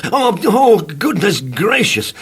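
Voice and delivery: high, panicky squeal of alarm; mild comic panic; frightened cry. A narrator's reading. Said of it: "cried Mister Jelly".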